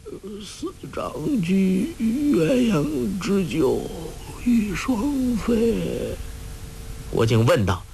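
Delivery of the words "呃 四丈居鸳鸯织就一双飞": put-on very slow, high, drawn-out old man's rambling; delirious murmuring. A narrator's reading. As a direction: recite dreamily in a delirium, stretching each word. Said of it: "只听他喃喃地说".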